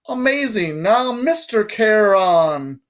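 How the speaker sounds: high, drawn-out patronizing British voice; strange British accent. A narrator's reading. Guard makes boy repeat his title, strange British accent. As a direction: stretch the title grandly in high British tones. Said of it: "said in a strange accent, British maybe".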